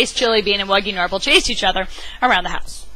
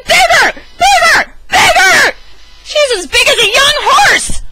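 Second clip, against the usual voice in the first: high, loud repeated yells; triumphant shouts, slowing on the last line; shouting; 8 dB louder, slower than usual. Acted. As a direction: keep yelling in delight. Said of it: "Lunchbox Louie shouted".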